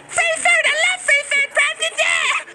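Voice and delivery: high, excited cartoon girl begging for food; voiced game character; squeaky character voice. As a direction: play a greedy little anime girl.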